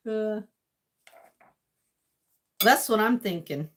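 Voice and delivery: usual speaking voice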